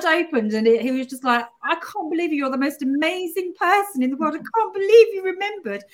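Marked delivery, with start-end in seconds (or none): 1.6-5.8 high, teary overwhelmed gushing; tearful gush. Retold or imitated. Her husband phoned about salmon, she gushes tearfully high. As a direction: gush through tears at his kindness. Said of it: "I just went into this complete meltdown".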